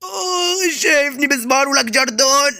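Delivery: high cry of pain, then a complaint; hammed-up pained wail; pained outcry. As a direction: howl as if just hurt. Hurt, crying out at Jerry.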